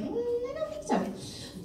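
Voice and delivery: high, soft, drawn-out doubt; doubtful mom. Retold or imitated. Mom answering dad's ferry boat plan, high soft mock doubt. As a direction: imitate a sceptical mother in a small high voice.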